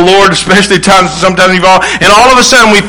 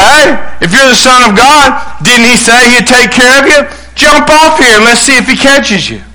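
higher-pitched mock challenge; taunting dare; 6 semitones higher than usual. Satan dares the Son of God to jump, taunting. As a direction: goad him into jumping. Acts out Satan daring Jesus.

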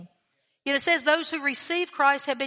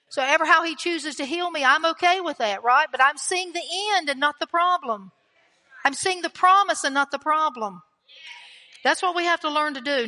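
raised, driving sermon delivery; forceful preaching; 3 semitones higher, 4 dB louder than usual. Real, not acted. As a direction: declare it with conviction to the congregation. Preacher builds into forceful declaration.

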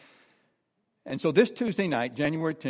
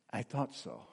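retold hushed, gentle answer, much softer; soft reply; 3 semitones lower, 12 dB quieter than usual. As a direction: say it softly, as she did. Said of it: "And she softly said".

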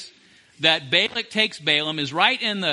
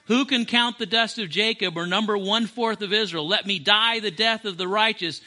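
normal talking voice

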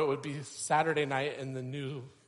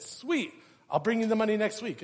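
much higher, quick, thrilled yes; delighted friend; 9 semitones higher, 7 dB louder than usual. Retold or imitated. Church friend thrilled to get the iPod cheap, quick. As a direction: jump at the bargain.

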